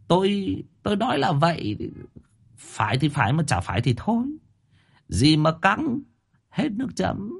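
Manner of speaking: high, sheepish squeak of excuse; defensive high voice. A narrator's reading. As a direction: shrug off the glares in a high voice. Thọt defends himself, high voice. (giọng cao, chống chế ngượng ngùng)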